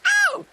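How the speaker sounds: piercing high bird cackle; very high magpie chatter; shouted ow, then cackle. A narrator's reading. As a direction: squawk into a rapid loud laugh. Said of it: "shouted Sticky Beak".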